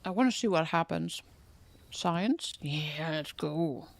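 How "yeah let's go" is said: ordinary talk, nothing marked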